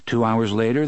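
usual speaking voice

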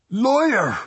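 very high, loud scream; outraged cry. A narrator's reading. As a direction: shriek the accusation. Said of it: "Missus Doolin cried".

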